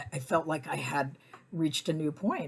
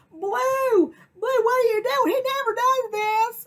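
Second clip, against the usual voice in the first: much higher, louder alarmed call; flustered lady; 9 dB louder, slower than usual. Retold or imitated. Lady calling her pit bull Blue, high and alarmed. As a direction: call the dog back in dismay. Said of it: "the lady comes around".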